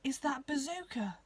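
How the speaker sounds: breathless, awed whisper; gasped whisper. Acted. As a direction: gasp it out in wonder. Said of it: "gasped Frolix".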